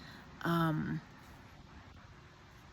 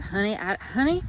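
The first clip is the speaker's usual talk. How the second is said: put-on Southern accent; 2 semitones higher, 4 dB louder than usual. Speaker putting on their best Southern accent, playful. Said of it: "in my best Southern accent".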